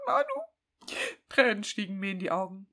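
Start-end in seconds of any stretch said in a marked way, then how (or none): 0.1-1.0 high, squeaky hammed-up call of the name; shrill melodramatic calling of the name; mock anguished cry. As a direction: cry out the name in over-the-top despair. Hammed-up dramatic reading.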